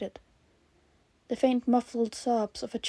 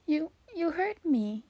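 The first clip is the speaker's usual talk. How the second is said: put-on high, halting child's voice; tearful child; 6 semitones higher than usual. Rescued child to host who listened, overwhelmed. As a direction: stammer it small and high, pitch swooping.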